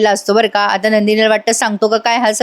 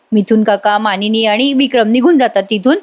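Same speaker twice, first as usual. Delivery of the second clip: ordinary delivery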